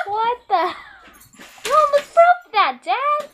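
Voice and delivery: loud, high-pitched protest; real outraged shout at a parent; indignant yell. Real, not acted. Child scolding Dad for almost breaking it, yelling.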